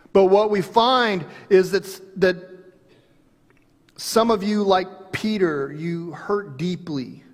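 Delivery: rising, loud, then slow preaching. Acted. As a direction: build the point to the hurt. Preaching at full force.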